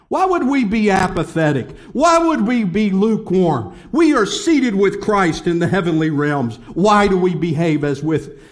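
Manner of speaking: loud, driving sermon voice; fiery preaching. Acted. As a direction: preach it hard to the crowd. Preaching at full force.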